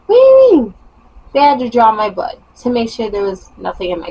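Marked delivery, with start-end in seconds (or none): 0.0-0.7 rising, louder disbelief; shocked, voice climbing higher; what do you mean, outraged and incredulous. Retold or imitated. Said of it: "I'm like".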